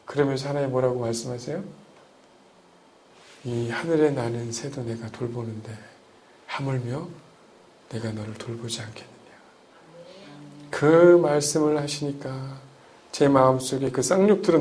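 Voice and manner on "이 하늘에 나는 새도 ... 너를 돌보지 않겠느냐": soft divine reassurance. Acted. Voicing God's comfort.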